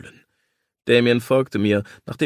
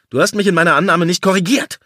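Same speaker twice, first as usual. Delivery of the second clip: louder, higher, fast angry hiss; hissed accusation; 7 semitones higher, 8 dB louder, faster than usual. Acted. Lawyer accuses his partner of tricking him, fast and louder. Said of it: "fauchte ich".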